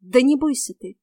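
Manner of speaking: usual speaking voice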